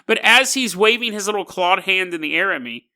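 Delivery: usual speaking voice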